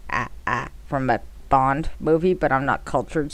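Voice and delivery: ordinary delivery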